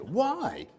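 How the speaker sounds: high squeaky why; baffled newcomer asking why; incredulous why. Retold or imitated. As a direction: pop the why up high in disbelief. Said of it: "He said".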